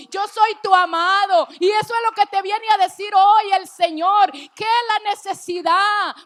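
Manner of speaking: loud, high, impassioned preaching at full force; prédica muy emocionada. Real, not acted. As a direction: build to a fervent shouted peak, then ease off slightly. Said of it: "disculpe que yo me emocione tanto". (predicación apasionada, a voz en grito)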